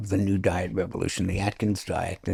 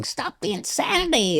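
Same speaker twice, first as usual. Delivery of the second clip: Susan Powter screaming. Retold or imitated. Imitating an infomercial host screaming at viewers, high mock scream. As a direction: mimic a high-pitched infomercial cry. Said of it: "Susan Powter was on the infomercial screaming".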